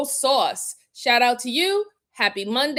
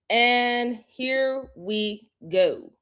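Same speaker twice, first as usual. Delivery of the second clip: ordinary talk, nothing marked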